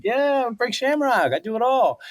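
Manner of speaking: playing the overconfident champ; swaggering self-parody; cocky boast. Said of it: "I'm like".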